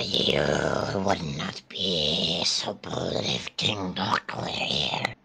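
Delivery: slow hushed whisper. Pretend. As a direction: breathe it out as a warning. Dubbing a character.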